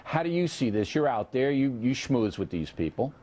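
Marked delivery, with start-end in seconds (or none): none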